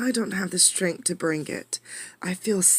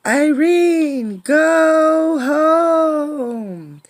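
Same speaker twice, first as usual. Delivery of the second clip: high, loud, wailing storm cry; howling wind; 11 semitones higher, 8 dB louder, much slower than usual. Acted. The wind wails Irene's name, loud and high. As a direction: wail her name like a gale.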